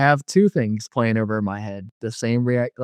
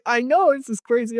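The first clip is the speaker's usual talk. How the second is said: very high, worked-up agreement; excited retelling; 12 semitones higher than usual. Retold or imitated. Agreeing with his friend about the vision, excited. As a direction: agree with pitch shooting way up. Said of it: "I was excited".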